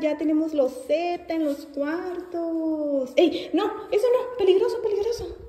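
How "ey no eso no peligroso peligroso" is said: sharp high no, no. Real, not acted. Toddler grabbing things.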